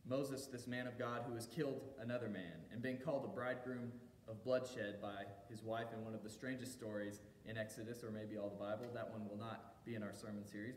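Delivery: ordinary delivery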